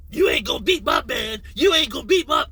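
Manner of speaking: high-pitched mock hollering; mocking emotional yeller. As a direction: mimic an overwrought man yelling courtside. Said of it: "that's what you acting like".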